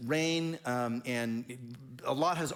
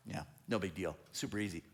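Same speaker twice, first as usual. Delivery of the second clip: much softer, offhand and cool; mock nonchalant brag; 6 semitones lower, 8 dB quieter, faster than usual. Preacher voicing David boasting about slaying Goliath, offhand and cool. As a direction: shrug it off like it was nothing.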